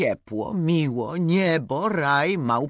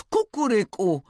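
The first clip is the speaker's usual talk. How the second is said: second crow much higher; rooster crow; 9 semitones higher than usual. Acted. Roosters crowing at each other in the yard, rising crow. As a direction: crow like two fighting roosters. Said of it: "krzyknął kogut".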